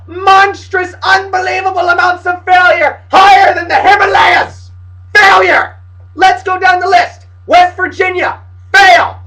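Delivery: high, loud hammed-up outrage; ranting shout. Fan listing Big East teams' failures, ranting shout. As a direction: bellow each failure like a verdict.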